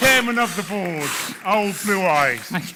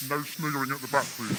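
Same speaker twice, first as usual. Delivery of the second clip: gas-lowered voice; 5 semitones lower, 8 dB quieter, much faster than usual. Real, not acted. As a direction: lower and softer after breathing heavy gas. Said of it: "your voice should go down in pitch".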